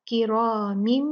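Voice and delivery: किरामिम with meem held two counts; two count ghunna. Shown as a demonstration. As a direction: hold the meem of किरामिम nasal. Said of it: "दो count गुन्ना करेंगे".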